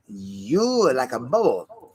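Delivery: slow, drawn-out wise-sage delivery; half-joking sage pronouncing wisdom; mock proverb voice. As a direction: intone it like an ancient proverb. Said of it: "I love Chinese proverbs".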